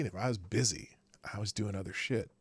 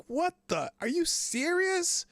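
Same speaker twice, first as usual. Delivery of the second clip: much higher, slower stunned disbelief; slower than usual. Retold or imitated. Host retelling hearing 9/11 on car radio, stunned disbelief. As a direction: replay the shock of hearing it on the radio.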